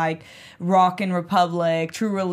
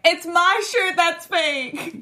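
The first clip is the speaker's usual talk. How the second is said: high, drawn-out imagined confession; mock calling out; 10 semitones higher, much slower than usual. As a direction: stretch it out high, as if announcing to everyone.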